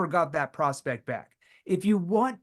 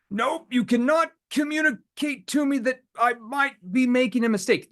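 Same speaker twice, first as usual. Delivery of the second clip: defensive prospect; 7 semitones higher, 5 dB louder than usual. Retold or imitated. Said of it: "They're all gonna be like".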